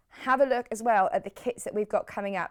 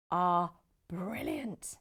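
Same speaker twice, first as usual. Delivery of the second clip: normal talking voice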